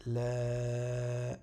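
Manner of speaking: LA held long and level; prolonged vowel. Shown as a demonstration. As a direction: draw out the vowel in LA. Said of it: "la prolongation du alif".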